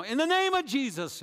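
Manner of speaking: loud, high cry of faith; fervent shout. Retold or imitated. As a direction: shout the name of Jesus over him. Convert shouting prayer, retold.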